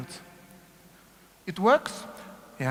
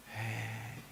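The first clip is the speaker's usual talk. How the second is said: sinking heavy cue; 8 semitones lower, 12 dB quieter than usual. Shown as a demonstration.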